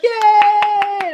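high, loud mock kid cheer. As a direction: squeal it like an excited kid. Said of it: "And the kid's like".

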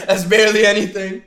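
laughing. Real, not acted. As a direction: crack up at the outfit. Laughing at skimpy outfit photo.

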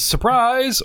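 high, sung-out surprise; spring it on them in a high voice; gleeful game master surprise; mock surprise shout.

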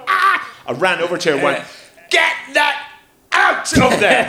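mock shock at a friend; playful shout. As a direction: tell her off in a playful shriek. Scolding friend picking nose.

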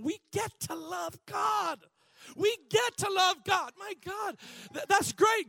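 high, loud shout of joy; full-force preaching. Acted. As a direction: proclaim it with all force.

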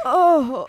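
loud drawn-out groan. Real, not acted. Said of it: "Just groaning".

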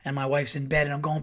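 ordinary talk, nothing marked

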